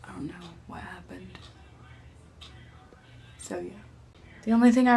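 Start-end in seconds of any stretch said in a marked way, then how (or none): 0.0-3.7 whispering. Real, not acted. Whispered aside.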